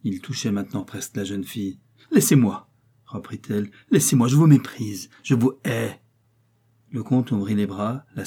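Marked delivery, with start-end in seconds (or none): 2.1-2.7 defiant cry. Acted. Riri resists the count. 3.9-6.0 angry defiance. Acted. Riri resists the count.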